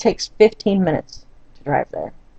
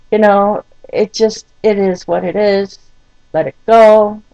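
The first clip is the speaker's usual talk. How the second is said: ordinary talk, nothing marked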